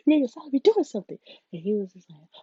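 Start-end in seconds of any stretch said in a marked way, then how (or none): 0.1-1.2 higher and louder, indignant laugh-through; laughing loud complaint. Real, not acted.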